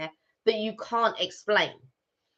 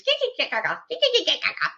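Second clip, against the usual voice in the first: put-on high-pitched giggling girl babble; mock a lovestruck girl's silly titter; giggly smitten girl; 11 semitones higher, faster than usual. How girls are when they like someone, giggly and high. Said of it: "how girls are when they like someone".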